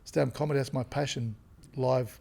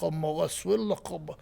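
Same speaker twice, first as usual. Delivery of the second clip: mock Lebanese accent; 2 semitones higher than usual. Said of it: "How did you get that accent".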